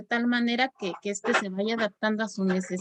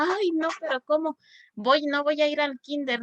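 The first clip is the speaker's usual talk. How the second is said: put-on a mild child's wail of dismay, pitched higher; mock childish protest, somewhat higher; dismayed little girl; 4 semitones higher than usual. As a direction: lightly voice the little girl horrified at missing kinder. Child dismayed at missing kinder.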